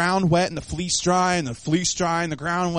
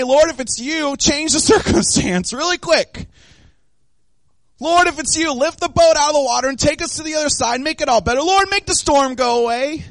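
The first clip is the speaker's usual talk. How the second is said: raised, louder begging; mock urgent plea; 5 semitones higher, 5 dB louder than usual. Preacher acting what Peter didn't say, urgent plea. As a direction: plead for a quick way out, high and loud.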